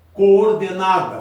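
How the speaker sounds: coordenada stretched slow and loud, co and or apart; slow, loud syllables. Shown as a demonstration. Teacher to class, sounding out coordenada slowly and loudly. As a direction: drag coordenada out, hitting both Os. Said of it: "dois Os, um ao lado do outro".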